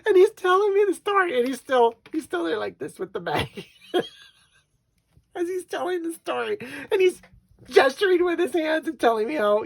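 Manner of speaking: high, loud, squeezed by laughing; helpless laughter. Real, not acted. Wife recalling husband's retelling, laughing helplessly. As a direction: fight to keep talking while cracking up. Laughing as she tells the story.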